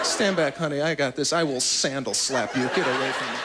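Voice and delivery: low, puffed-up voice of a flimsy hero; mock tough guy. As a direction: play the wimp pretending to be brave. Trevor acting tough for date.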